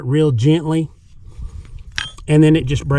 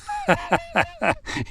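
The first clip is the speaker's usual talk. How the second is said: short laugh; 7 dB quieter than usual. Real, not acted. Laughs at can instructions.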